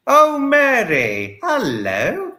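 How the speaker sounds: high, slow, drawn-out delighted hello; delighted greeting. Real, not acted. Greeting viewer Mary warmly on stream, high and drawn out. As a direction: stretch her name out in delight.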